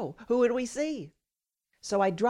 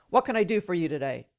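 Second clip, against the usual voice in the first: quick, sharp doctor's question; imitated sharp tone; 2 semitones lower, faster than usual. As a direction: rattle it off curtly, like a brusque doctor. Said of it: "in a sharp tone".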